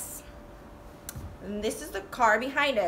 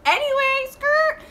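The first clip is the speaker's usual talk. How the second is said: very high, louder squeak; squeaky silly; 14 semitones higher, 5 dB louder than usual. Real, not acted. Jokingly squeaking to change the subject. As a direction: pop it out in a silly falsetto.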